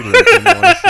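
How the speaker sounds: laughing through the words; loud, high, giggling laugh. Real, not acted. Laughing at own bad joke.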